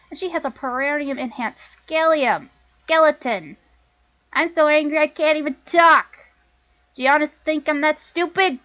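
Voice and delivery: heated scolding with loud bursts; angry sister. Acted. Sister demanding why Lana brought these people, angry. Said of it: "let me get into my angry sister, uh, uh, voice here".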